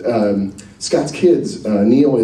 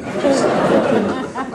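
ordinary talk

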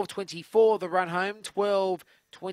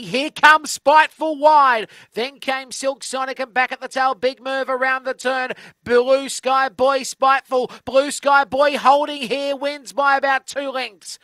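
loud, rising race climax; excited race call; 5 semitones higher, 8 dB louder than usual. Acted.

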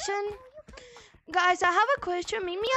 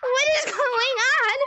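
loud, high, laughing cry; shocked laughing shout; 7 semitones higher, 5 dB louder than usual. Real, not acted. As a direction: shriek it in disbelief.

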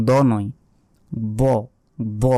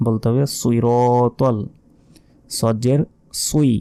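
usual speaking voice

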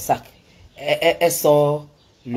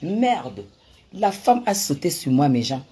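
usual speaking voice

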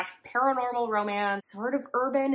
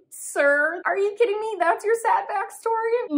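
high, loud disbelief, mocking the reveal; sir, are you serious, half-laughing outrage; incredulous; 12 semitones higher, 7 dB louder than usual. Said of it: "I was like".

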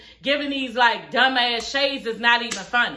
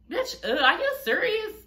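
are you serious, asked in stunned disbelief; high incredulous question; 7 semitones higher than usual. Real, not acted. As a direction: pitch jumps way up in disbelief. Disbelief at the decision.

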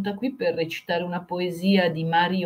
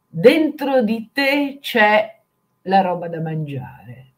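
theatrical swelling poetry reading; 3 dB louder than usual. Shown as a demonstration. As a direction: declaim each line like a surreal poem on stage. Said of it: "bisogna recitarla in un certo modo". (lettura poetica teatrale e crescente)

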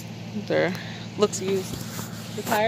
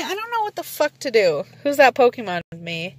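ordinary talk

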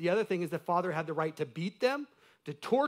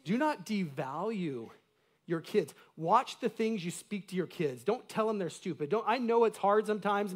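usual speaking voice

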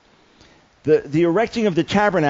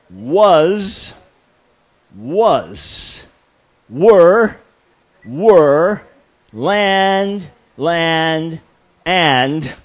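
loud, halting, word-by-word reading of only the italics; 5 dB louder, much slower than usual. Shown as a demonstration. Preacher demonstrating his secret code. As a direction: read each word with weight, pausing between them. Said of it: "So here we go".

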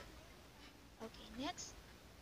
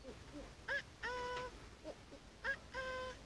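monkey hoots jumping to high "ah ah"; imitated monkey call; 10 semitones higher, faster than usual. Shown as a demonstration. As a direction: hoot like a monkey for a guessing game. Imitates monkey for guessing game.

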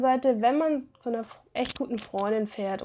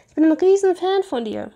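gushing fan; 6 semitones higher, 5 dB louder than usual. Acted. Fan meeting his idol.

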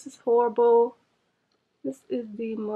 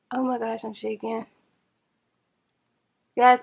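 ordinary talk, nothing marked

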